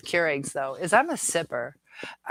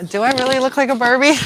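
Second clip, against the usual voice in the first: giddy question; 7 semitones higher, 11 dB louder, faster than usual. Real, not acted. Flattered by Barbie comment.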